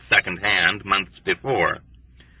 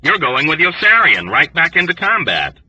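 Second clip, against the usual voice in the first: higher, curt and sharp reply; mild asperity; 6 semitones higher than usual. A narrator's reading. Doctors answering Dunbar, mildly sharp. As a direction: cut him down briskly. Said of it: "they replied with asperity".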